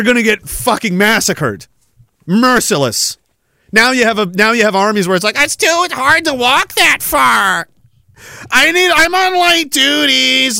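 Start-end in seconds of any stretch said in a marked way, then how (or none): 5.4-10.6 high-pitched, drawn-out whiny complaint; mock whining. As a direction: drag it out like a feeble complainer. Mocks soft soldiers' excuses.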